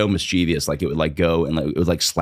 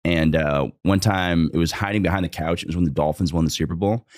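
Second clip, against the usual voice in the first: usual speaking voice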